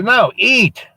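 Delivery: put-on high, loud childish demand; little girl insisting. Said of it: "She goes".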